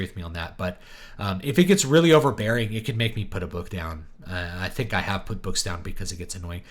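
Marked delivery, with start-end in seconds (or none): none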